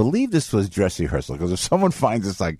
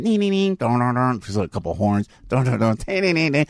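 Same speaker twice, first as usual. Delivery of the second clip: sung band tune-up; 4 semitones higher than usual. Retold or imitated. Imitating band tuning up.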